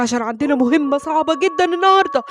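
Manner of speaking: normal talking voice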